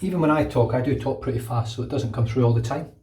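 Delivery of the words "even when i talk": ordinary delivery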